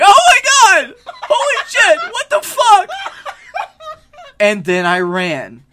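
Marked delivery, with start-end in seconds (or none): 0.0-2.9 mock scream. Retold stranger screaming.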